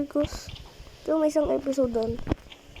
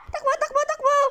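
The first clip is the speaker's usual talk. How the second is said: high frantic urging; panicked shouting; 12 semitones higher than usual. Real, not acted. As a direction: yell to flee in panic. Urging to run.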